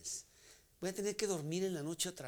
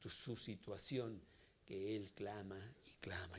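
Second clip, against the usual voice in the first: whisper; 7 semitones lower, 10 dB quieter than usual. Acted. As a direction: sink the voice into an intimate whisper. Sermon sinks into whisper.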